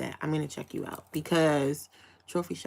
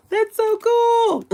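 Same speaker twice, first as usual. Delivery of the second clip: drawn-out gush; 6 dB louder, much slower than usual. Real, not acted. Gushing over viewer in Scotland.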